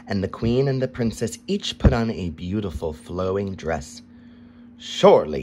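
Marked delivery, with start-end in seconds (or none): 4.8-5.4 louder, proud boast; king boasting of his magnificence. A narrator's reading. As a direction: puff up with royal pride. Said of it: "he boasted".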